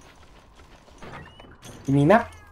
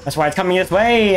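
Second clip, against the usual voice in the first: ordinary delivery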